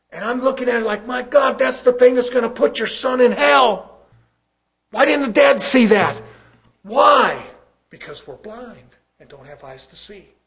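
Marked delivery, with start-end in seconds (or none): 0.1-7.4 raised, high preaching voice; impassioned pulpit delivery; forceful preaching. Real, not acted. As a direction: preach it loudly with urgency.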